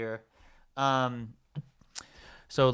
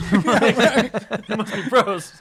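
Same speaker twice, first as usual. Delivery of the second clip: much higher and louder, slow, through laughter; laughing dopey echo; 9 semitones higher, 8 dB louder, much slower than usual. Retold or imitated. Repeating the gas station line, high and laughing. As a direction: drag it out in a high goofy voice while laughing. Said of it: "And she's like".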